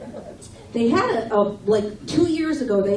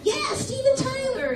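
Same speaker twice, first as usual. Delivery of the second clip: squeaky imitation of the man at the bar, much higher; guy at the bar, mimicked in a high voice; high mock voice of the guy. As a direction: pitch it way up, mocking him. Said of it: "He goes".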